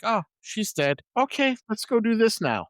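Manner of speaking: high, breezy shrug-off; mock unconcern. Mimicking characters' careless reaction to death.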